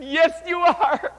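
slow, high, loud "Yes" pressed home; emphatic insistence. Retold or imitated. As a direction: refuse her refusal, drawn out. Pastor insists against Carol.

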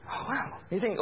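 usual speaking voice

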